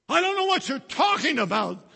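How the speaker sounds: high, loud protest; panicked denial. Acted. Peter denying Jesus to the servant girl, loud high protest. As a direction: shout the lie to get away.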